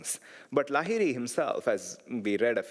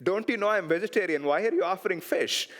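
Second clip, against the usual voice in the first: higher, mildly angry retort; retold anger; 7 semitones higher than usual. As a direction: snap back a little, as the offended guru. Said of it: "gets angry and looks at him and says".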